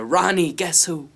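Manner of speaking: high shouted summons; calling out. Acted. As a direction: holler for your son to come and see. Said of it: "my father calls out".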